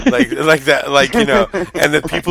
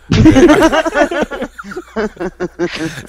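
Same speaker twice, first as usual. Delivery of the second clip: long high laugh bursting out loud; real hearty laugh; big laugh; 12 semitones higher, 5 dB louder than usual. Real, not acted. Big laugh at McNoodle joke.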